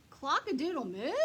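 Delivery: a cow getting the words wrong, loudly; cow's clumsy crow. Acted. Cow's questioning attempt.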